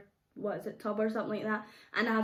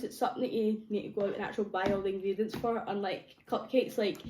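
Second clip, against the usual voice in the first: ordinary talk, nothing marked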